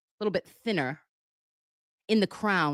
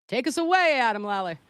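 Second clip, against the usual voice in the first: hyped intro; 5 semitones higher, 5 dB louder than usual. Acted. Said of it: "cueing the clip, called out".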